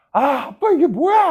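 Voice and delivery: mock the kids' shrieking protest; kids shrieking. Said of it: "하면서 소리 지르라고". (아빠에게 소리 지르는 아이들 흉내, 높은 비명)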